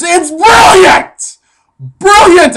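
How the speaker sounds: slow, loud, high shout of BRILLIANT; excited shout. Real, not acted. Hailing his own meat square idea, shouting high and loud. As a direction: bellow it like a mad inventor.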